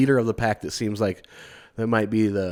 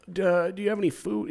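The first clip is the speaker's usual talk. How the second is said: timid beggar; 8 semitones higher, 4 dB quieter than usual. Retold or imitated. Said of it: "And he was like".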